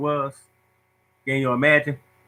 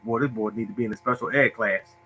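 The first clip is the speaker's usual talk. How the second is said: teacher's private thought; 4 semitones lower, 7 dB quieter than usual. Retold or imitated. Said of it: "I know my teacher's like".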